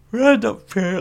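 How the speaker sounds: words stretched by a yawn. Real, not acted. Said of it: "why am I yawning?".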